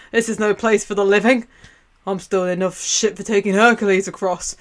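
put-on loud, grumbling old boatman refusing passengers; grumpy ferryman. Charon shouting at living visitors to clear off, grumpy. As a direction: bark him off the boat like a cranky ferryman.

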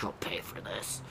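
soft unvoiced threat; menacing whisper. Brother whispering revenge threat at his sibling.

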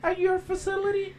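very high, put-on old lady voice. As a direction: imitate a lady customer asking politely. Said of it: "That's your lady voice?".

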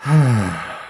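long, resigned sigh; heavy acted exhale. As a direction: let out a weary breath. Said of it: "looked up at the sky and sighed".

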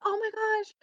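high, slowed, delighted; retold happy surprise; excited recognition. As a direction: squeal of recognition. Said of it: "so I was like".

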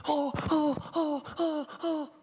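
short high repeated "oh"s; a string of hammed-up fretful whimpers; mock panicked whimpers. Anxious person interacting with family, mock high panicked whimpers.

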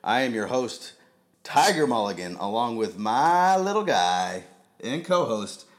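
big showman announcer voice; grand entrance announcement. Acted. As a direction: welcome them in like a ringmaster. Podcast host intro announcement.

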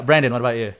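usual speaking voice

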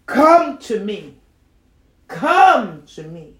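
loud, slow, stretched-out call; preacher's scripture call. Real, not acted. Calling the burdened with Jesus's words, slow loud preaching. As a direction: call it out with long pauses between phrases.

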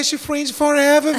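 ordinary talk

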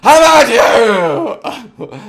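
loud, high mock yell; hammed-up shouting gentleman; yelling snob. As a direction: bellow "how about you" at him. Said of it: "Am I yelling in your ear".

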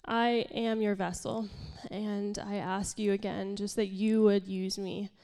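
ordinary talk, nothing marked

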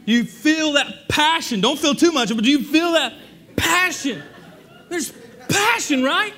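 building to a full preaching shout; fervent preaching. Real, not acted. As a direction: shout the passion out. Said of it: "Can you hear the passion?".

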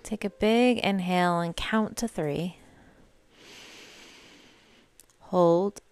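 unhurried meditation voice with long pauses; guided meditation. Real, not acted. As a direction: guide listeners gently through each breath, leaving space between phrases. Leads a meditation.

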